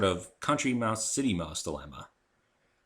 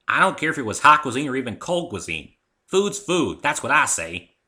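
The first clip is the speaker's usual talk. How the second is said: raised, higher, louder and emphatic; loud country retort; 6 semitones higher, 11 dB louder than usual. Acted. Applejack scoffing loudly at fancy food talk. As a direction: belt it out like a blunt farm girl.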